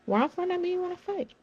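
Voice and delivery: mock challenge.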